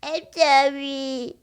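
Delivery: put-on very drawn-out, high and loud baby talk; babyish receptionist voice. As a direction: stretch it into a mock baby apology. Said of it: "turn into a baby".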